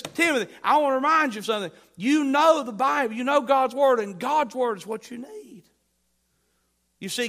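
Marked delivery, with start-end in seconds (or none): 0.0-5.6 Paul's plea, higher, louder and fast; a rising, driving plea to remember the Bible; fervent Paul. Retold or imitated. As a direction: urge Timothy at full preaching force. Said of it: "He says".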